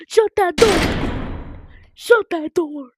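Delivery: urgent shout. Real, not acted. Urgent yell at game door.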